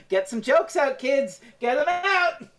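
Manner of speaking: high, teasing call to the others; egging them on. Real, not acted. Host teasing cohosts to crack jokes, rising high call. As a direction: playfully goad them, pitch climbing. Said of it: "urging the cohosts to joke".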